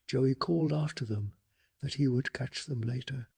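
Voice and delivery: ordinary delivery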